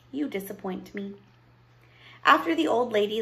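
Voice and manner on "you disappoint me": hushed, much softer voice; whispered. A narrator's reading. Old woman leans close to Edward, hushed. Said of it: "leaned close to him and whispered".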